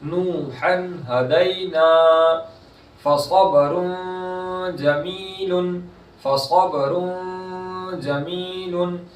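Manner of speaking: slow, drawn-out Quranic chanting; tajweed recitation. Shown as a demonstration. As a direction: stretch the vowels in recitation. Recites example.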